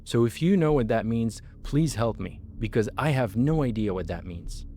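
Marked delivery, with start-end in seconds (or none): none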